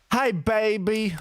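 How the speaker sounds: high, slow loving coo; tender. Pretend. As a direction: coo sweetly to a loved one. Greeting his mannequin wife.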